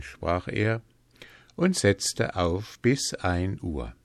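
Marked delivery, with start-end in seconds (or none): none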